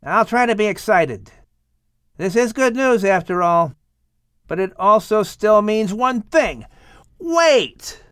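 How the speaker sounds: Ham's voice. Acted. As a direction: try to sound excited, then catch a thought. Ham answers his father.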